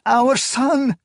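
high acted sob; tearful, pleading wail; sobbing. A narrator's reading. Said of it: "she sobbed".

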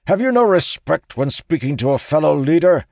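loud, high outraged demand; indignant shout. A narrator's reading. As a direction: shout it in his face. Sturgis still bellowing, offended.

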